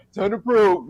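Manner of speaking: a hint of an alien voice; slow alien drawl on probe. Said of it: "see the alien voice".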